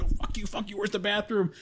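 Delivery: high, frantic rude snap; rude brush-off. Retold or imitated. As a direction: blow off the staff in desperation. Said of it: "I'm being rude as shit".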